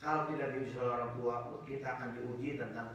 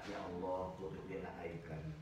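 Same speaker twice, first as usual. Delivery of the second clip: softer, lower plea to God; humble prayer; 5 semitones lower, 7 dB quieter than usual. Acted. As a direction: pray it humbly. (doa yang lirih dan rendah hati)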